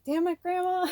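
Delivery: breaking into tears. Real, not acted. Remembering grandma's last words, she bursts into high crying. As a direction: voice breaks as she starts to cry over grandma. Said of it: "Oh, I'm gonna start crying".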